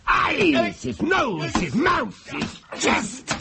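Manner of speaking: high, shouted with each blow; possessed, high-pitched punching delivery; frenzied narration. Acted. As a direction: cry out each strike. Narrator relives striking the portrait.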